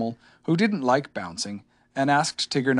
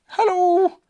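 high, louder calling bear voice; Pooh; 6 dB louder than usual. A narrator's reading. Pooh greets whatever is outside, high call. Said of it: "said Pooh".

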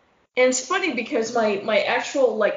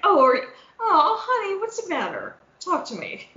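mock comforting; 8 semitones higher than usual.

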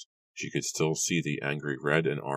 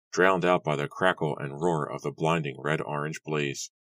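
ordinary delivery

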